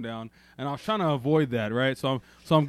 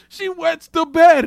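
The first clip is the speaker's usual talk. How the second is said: loud, higher, slow laughing reveal; laughing punchline; 10 semitones higher, 13 dB louder, much slower than usual. Retold or imitated. Friend revealing the pills, laughing loudly. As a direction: crack up while you break the news.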